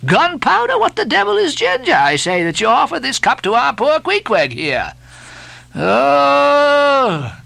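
high, scornful sailor's tirade; mocking mate. Stubb mocking the steward's ginger cup, scornful and high.

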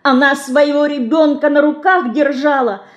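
put-on high child's voice, tearful and angry; «держала» thrown out high in anger; tearful angry shout. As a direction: accuse the icon through tears. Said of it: "со слезами и гневом кричала мышка".

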